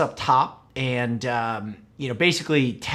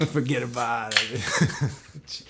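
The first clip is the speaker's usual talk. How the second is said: slow mock New York "forget about it"; hammed-up New York drawl on "Forget about it"; stretching out a New York catchphrase; New York accent; much slower than usual. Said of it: "You guys don't have any accents".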